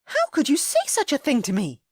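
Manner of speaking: high, loud, outraged lady; shocked outrage. A narrator's reading. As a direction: demand it in wounded shock. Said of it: "shock and outrage flaring".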